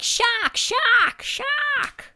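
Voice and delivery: high, loud mock shouts of shark; mock shouting. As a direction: call it out over and over, high and insistent. Acts out repeated shouts of shark.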